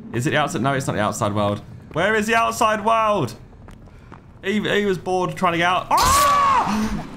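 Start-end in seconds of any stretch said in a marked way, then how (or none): 5.9-7.1 sudden high shriek of fright; jump-scared yell; startled scream. Real, not acted. Gamer jump-scared by a screeching monster, shrieking high.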